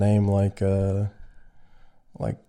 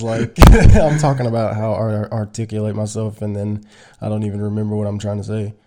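ordinary talk, nothing marked

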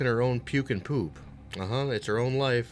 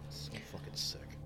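a shocked aside under the breath; horrified whisper; 15 dB quieter than usual. Real, not acted. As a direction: whisper it in disgust.

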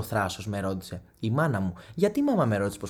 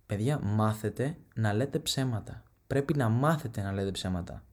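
usual speaking voice

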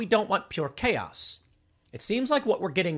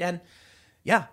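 ordinary talk

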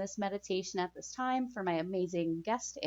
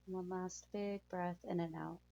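calm soothing guide through breathing; guided meditation; 8 dB quieter than usual. Acted.

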